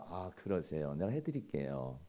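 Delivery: low, soft, obliging voice; soothing agreement. Acted. Husband offers to help his upset wife, gently obliging. Models soothing reply to anger.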